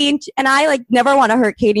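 ordinary talk

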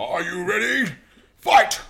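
big ring announcer call; boxing announcer. Pretend. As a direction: shout it like a fight announcer.

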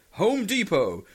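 slow announcer read of the prize; hammed-up prize announcer; mock prize announcer. Reading out next spam prize.

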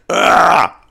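sharp high embarrassed shriek; cringing yelp. Real, not acted. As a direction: yelp as if caught out. Cringing at the smoking question.

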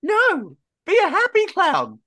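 high, emphatic correction; high-pitched pep order; exasperated urging. Acted. Player urges clown to cheer up.

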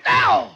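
sharp loud "ow" when elbowed; short startled yelp; yelp of pain. Acted. Jabbed with the captain's elbow.

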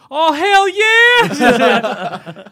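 huge high shouted "Oh, hell yeah!" drawn out with a laugh; mock excited whoop. Comedian impersonating a politician cheering, loud drawn-out laughing whoop. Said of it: "they're like".

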